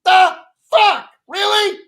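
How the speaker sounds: high, incredulous outburst; stunned disbelief. Retold or imitated. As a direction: react like you can't believe it. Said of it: "And I'm like".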